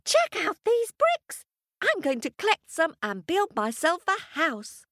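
very high little piglet voice; squeaky little pig. Acted. Little Pig delighted at the bricks, very high and squeaky. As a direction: speak up high like the tiniest pig.

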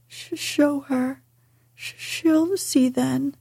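broken, sobbing stammer; gasping between sobs. Acted. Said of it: "he gasped out between two sobs".

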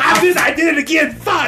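giggling through the words; cracking up at the slip; laughing. Real, not acted.

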